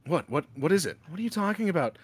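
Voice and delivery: usual speaking voice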